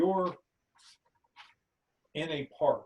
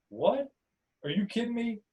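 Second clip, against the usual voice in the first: high, disbelieving what; can't believe what he hears; incredulous; 11 semitones higher, 4 dB louder than usual. Acted. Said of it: "stares at you incredulously and asks".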